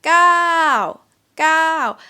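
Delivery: gao slow and high, going up then down; falling tone. Shown as a demonstration. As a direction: lift gao up first, then let it fall. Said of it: "For example, the word gao".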